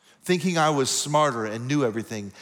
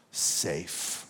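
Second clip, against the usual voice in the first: whispered; 8 semitones lower, 8 dB quieter than usual. Real, not acted. Preacher telling men his wife no longer felt safe, whispered. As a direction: breathe the word out, no voice. Sermon climax on lost safety.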